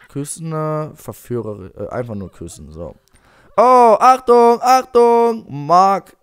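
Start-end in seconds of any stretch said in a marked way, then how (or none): 3.6-6.2 loud, high, drawn-out alert; alarmed warning. Pretend. Player warns game character Marc, loud mock alarm. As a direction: shout a sudden warning in play. Sudden alarm at Marc's action.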